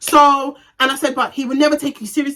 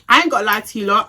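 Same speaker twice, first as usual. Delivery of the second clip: ordinary talk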